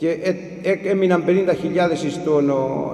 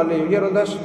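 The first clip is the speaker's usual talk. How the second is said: ordinary delivery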